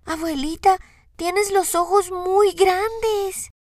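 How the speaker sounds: niña aguda, muy lenta y asombrada; voz de niña. A narrator's reading. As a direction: pregunta curiosa de niña pequeña. Voz de Caperucita. (niña asombrada)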